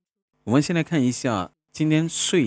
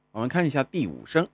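ordinary talk, nothing marked

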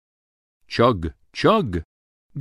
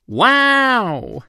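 high, loud delighted wow; amazed wow; 13 semitones higher, 4 dB louder than usual. Acted. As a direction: gasp it out in wonder. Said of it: "Bo says".

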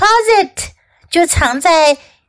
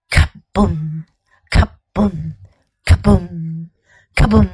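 low, heavy booming thump; heartbeat booms; 8 semitones lower than usual. A narrator's reading. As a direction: boom it like a pounding heart. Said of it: "her heart was going".

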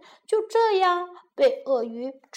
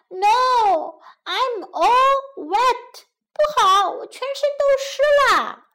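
whining gingerbread man; 10 semitones higher, 4 dB louder than usual. Acted. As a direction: complain in a tiny high voice.

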